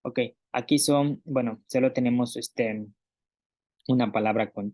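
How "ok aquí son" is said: normal talking voice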